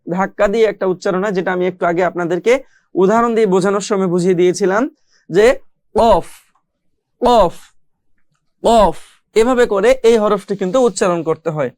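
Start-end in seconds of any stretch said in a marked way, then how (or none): 5.9-9.0 অফ pushed hard from the throat; throat-pushed letter sound. Shown as a demonstration. Teacher demonstrates a letter for students, pushed from the throat. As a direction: push অফ out from the throat. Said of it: "ধাক্কা দিয়ে পড়তে হয়".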